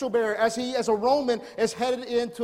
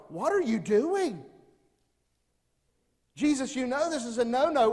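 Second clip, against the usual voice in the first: objecting crowd; 5 semitones higher than usual. Retold or imitated. Crowd objecting to Jesus going, mock protest. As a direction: scold Jesus as a shocked onlooker.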